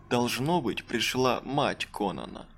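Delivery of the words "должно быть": usual speaking voice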